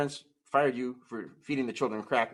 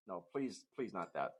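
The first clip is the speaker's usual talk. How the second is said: ordinary talk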